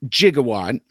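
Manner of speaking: "Gigawatt" said with a j, like jig; movie pronunciation, soft g. Shown as a demonstration. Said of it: "that's how Doc Brown says it".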